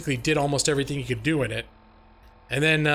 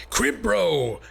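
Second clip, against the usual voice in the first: higher, falling excited call; all-caps greeting; 4 semitones higher than usual. Pretend. Streamer greeting a returning viewer, hammed-up call. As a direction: call the name out like a big greeting. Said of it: "I can't do it all, on all caps".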